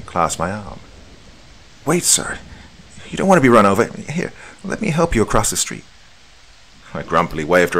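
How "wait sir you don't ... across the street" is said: put-on higher, eager young woman's voice; a kind stranger reaching for his arm; helpful young woman. Helpful young woman stops narrator.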